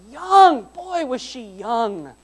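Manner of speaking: high, loud exclamation, then drawn out slowly; emphatic preaching. Real, not acted. Preacher telling the congregation of Mary's youth, emphatic exclaiming. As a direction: marvel loudly, stretching the words.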